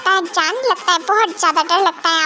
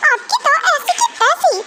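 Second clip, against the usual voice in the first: high playful threat to the husband; mock threat; 8 semitones higher than usual. Wife threatening her husband in mock fury. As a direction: threaten him, half teasing.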